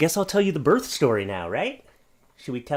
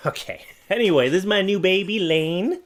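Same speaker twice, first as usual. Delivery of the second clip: usual speaking voice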